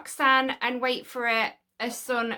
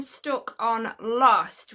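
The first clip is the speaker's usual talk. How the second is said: normal talking voice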